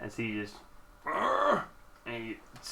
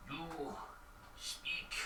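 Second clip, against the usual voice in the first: hushed, voiceless and broken; creature's halting speech; 3 semitones higher, 17 dB quieter than usual. Acted. Bugbear haltingly addressing the stranger, hushed creature voice. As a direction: bite off each word very softly, with gaps. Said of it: "he looks at you and he goes".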